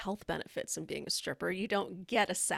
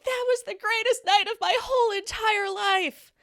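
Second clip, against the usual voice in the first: much higher, louder, elated; giddy thrill; 13 semitones higher, 6 dB louder than usual. Retold or imitated. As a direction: gush like it was the best night ever. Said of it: "And she's like".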